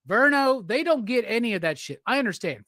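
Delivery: ordinary talk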